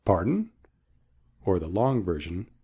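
ordinary talk, nothing marked

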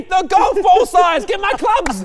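high loud slow yell; mock shout. Joking host yells about golf-ball hail, loud high shout. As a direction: bellow it in mock alarm.

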